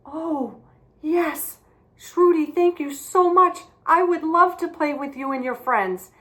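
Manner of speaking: high, loud, overjoyed thanks; a boy beaming with relief at being asked to play; delighted boy. Acted. Boy thanks girl joyfully.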